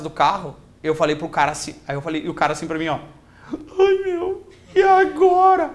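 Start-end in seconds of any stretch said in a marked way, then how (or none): 3.5-5.7 hammed-up high-pitched tearful wail; comic imitation of a weeping, helpless victim; crying. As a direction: wail it in mock tears. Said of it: "E o guri começou a chorar".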